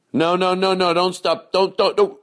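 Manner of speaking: high, loud, urgent no no no; frantic pleading. Acted. Pleading with the listener not to skip, high and frantic. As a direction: beg them not to stop.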